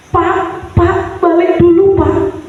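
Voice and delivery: high, pleading, tearful voice; lightly voiced crying wife; weeping wife's plea. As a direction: beg him to come back, near tears. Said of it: "Lalu istrinya menangis".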